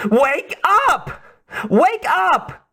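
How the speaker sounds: a Russian woman desperately urging him to wake; Russian-accented woman calling. A narrator's reading. Russian woman rousing the stunned spy, high urgent Russian-accented calls. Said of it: "It droned on like a mantra".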